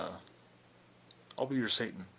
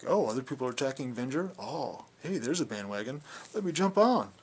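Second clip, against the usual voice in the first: sarcastic mimicry, rising high and loud; mock eagerness; 5 semitones higher, 6 dB louder than usual. Speaker mimics someone jumping on the bandwagon, mock-eager. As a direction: imitate an eager joiner, building to a loud high finish.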